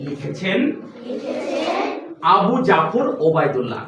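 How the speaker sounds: slow, measured title announcement; unhurried recital voice, pausing between phrases; poem recitation. Shown as a demonstration. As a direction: recite title and poet for a class to echo. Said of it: "যেভাবে বলি, একটু চেষ্টা করবে".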